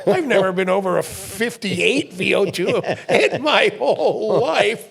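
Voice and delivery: laughing through the words; slowing into a laugh at the end; laughing disbelief. Real, not acted. Laughing at his VO2 score.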